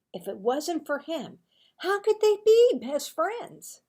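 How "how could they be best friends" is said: high, loud incredulous question; can't believe it, pitch jumping up; disbelieving. A narrator's reading. Marvin's disbelief.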